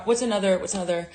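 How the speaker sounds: usual speaking voice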